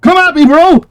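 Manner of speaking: loud, high cocky challenge; loud taunt. Acted. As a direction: shout it at the enemy. Taunting the enemy again.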